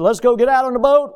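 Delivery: rally your buddy for a day on the water; eager invitation. Retold or imitated.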